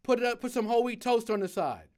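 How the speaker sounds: loud, high shouted order in a rush; shouting an order. Retold or imitated. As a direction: yell the order as the rush builds. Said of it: "he shouts out something again".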